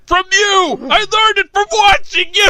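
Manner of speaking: high, melodramatic shout; mock outburst. Co-host quoting an accusing child to a parent, melodramatic shout. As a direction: shout it back like a hammed-up accusation. Acted quote of son's outburst.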